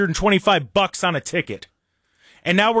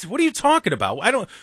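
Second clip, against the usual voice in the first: ordinary talk, nothing marked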